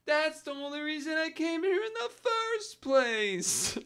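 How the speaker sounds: high, loud, drawn-out cartoon complaint; cartoonish old man. Old guy griping to a fellow shopper about missing bananas, cartoonish wail. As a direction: wail it like an exasperated sitcom character. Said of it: "and he goes".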